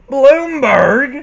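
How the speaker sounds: loud, high, shocked cry of the name; shocked gasp. Acted. Braeburn catches Applejack, a loud shocked outcry. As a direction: blurt the name in outrage. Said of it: "He gasped".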